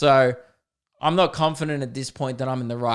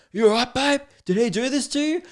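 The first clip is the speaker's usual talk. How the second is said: high-pitched put-on voice with rising questions; alarmed biker husband; 11 semitones higher than usual. Biker husband checking on his wife, imitated high urgent questions. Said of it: "he just gets off the thing and goes".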